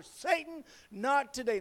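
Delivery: mock shout pitched very high at the start. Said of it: "I shouted even louder".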